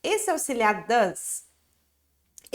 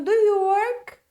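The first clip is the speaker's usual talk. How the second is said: higher, louder questioning voice; teacher playing the one who asks, higher and louder; acted question; 8 semitones higher, 5 dB louder, slower than usual. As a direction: ask it brightly up high, like the other speaker. Teacher acts both dialogue parts.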